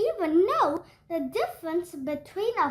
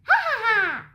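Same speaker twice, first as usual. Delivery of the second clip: mocking laugh; 5 semitones higher, 5 dB louder than usual.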